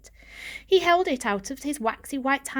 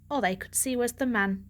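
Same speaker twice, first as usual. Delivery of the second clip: ordinary delivery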